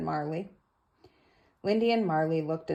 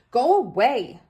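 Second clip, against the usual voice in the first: higher, louder shove-off; loud go away; 6 semitones higher, 9 dB louder than usual. Acted. George chasing Rashida from the house, loud. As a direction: push her away with the voice.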